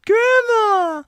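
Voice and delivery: shrill high-pitched Grandma squeak; squeaky high voice. Pretend. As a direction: jump way up into a squeak. Sudden silly voice.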